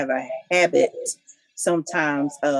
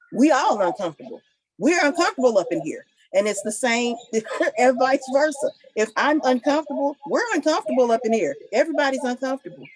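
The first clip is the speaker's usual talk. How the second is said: higher-pitched, passionate insistence; 6 semitones higher than usual. Real, not acted. Co-host insisting on respect for an uncomfortable spouse, passionate. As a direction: make the point with rising fervor. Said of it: "I just get kinda passionate about that".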